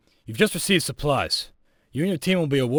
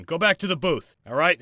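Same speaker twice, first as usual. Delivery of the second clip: usual speaking voice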